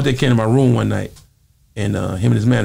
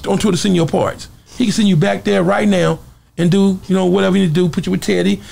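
quoted persuasion; 8 semitones higher than usual. Retold or imitated. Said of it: "I said".

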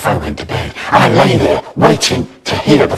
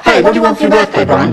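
put-on much higher, wheedling kid voice; coaxing little boy; 10 semitones higher, much faster than usual. A narrator's reading. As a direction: sweet-talk big brother.

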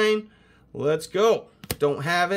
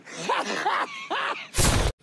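high shrieking laugh. Real, not acted. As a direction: let out a shrieking cackle. Laughs after mocking greeting.

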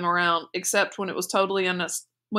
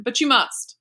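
raised, louder irritable insistence; 5 semitones higher, 5 dB louder, slower than usual. A narrator's reading. Bruno contradicting Schmuel, irritably insistent. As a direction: push back, exasperated with a friend. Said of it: "said Bruno irritably".

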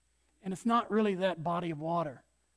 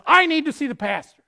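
retold demand, said much louder; 6 semitones higher, 13 dB louder than usual. Preacher retelling a visitor's demand at the office, loud. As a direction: call out loudly for the pastor. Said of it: "I heard him say in a loud voice".

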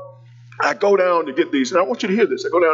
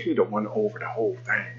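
lower, softer, hushed aside; whispered aside; 8 semitones lower, 8 dB quieter than usual. Retold or imitated. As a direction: lean in and keep it secret. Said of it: "I whisper stuff".

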